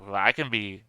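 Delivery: ordinary delivery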